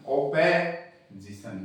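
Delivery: ओपेजिशन slowly split after "ope"; teacher's slow ओपेजिशन with an e sound; weak form. Shown as a demonstration. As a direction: draw out ओपेजिशन to show "pe" instead of "po". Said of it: "weak form हो जाती है".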